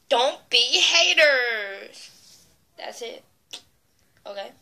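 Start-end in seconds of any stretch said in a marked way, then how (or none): 0.1-2.1 very slow, drawn-out words; slow emphatic plea. Acted. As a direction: stretch each word out.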